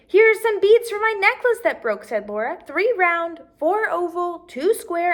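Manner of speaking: put-on a girl proudly offering beads; child voice.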